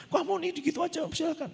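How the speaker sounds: mock scolding in a high, soft voice; menirukan omelan kesal. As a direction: scold a too-patient friend, pitched high but held down. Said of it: "saya udah marah". (pura-pura mengomel dengan suara tinggi dan pelan)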